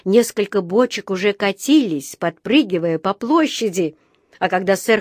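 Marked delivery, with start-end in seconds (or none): none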